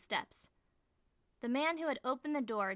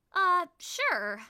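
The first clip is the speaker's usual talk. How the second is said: awkward, much higher and louder; uncomfortable; 9 semitones higher, 11 dB louder than usual. A narrator's reading. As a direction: push it out awkwardly. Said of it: "I say uncomfortably".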